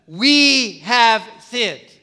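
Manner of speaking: "We have sinned" said very slowly and loudly, every word weighted; slow, heavy "We have sinned"; stress on we. Shown as a demonstration. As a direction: lean hard on "We have sinned", drawn out. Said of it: "what's the key word that you see there".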